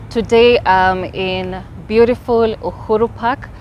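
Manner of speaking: normal talking voice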